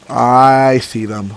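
very slow, stretched "I"; slow, lingering recognition; drawn-out realisation. Real, not acted. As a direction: draw out "I see them" slowly. Repeats a sighting, drawn out.

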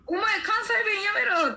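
playing the supervisor ordering her in Kansai dialect; Kansai-dialect scolding boss. New hire retelling her Kansai supervisor's scolding, mock Kansai bark. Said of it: "って関西弁で言われて".

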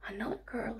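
hushed, curious whisper; whispering old man. Acted. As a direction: breathe it like a mysterious old man. Old man greets girl.

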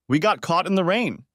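choppy delivery, pitched high. A narrator's reading. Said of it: "I said in a choppy voice".

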